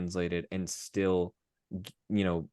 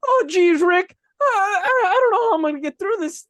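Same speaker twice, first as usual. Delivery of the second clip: very high, louder, fast panicky stammer; Morty Smith; 6 dB louder, faster than usual. Retold or imitated. A nervous teen telling his grandfather about his exam, high and panicky. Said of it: "talking to Morty Smith".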